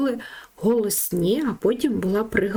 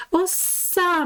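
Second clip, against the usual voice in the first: "оса" with the final А stressed; 8 semitones higher than usual. Shown as a demonstration. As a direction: lean on the last vowel of "оса". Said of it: "наголос на букву А". (наголос на останню А у слові «оса»)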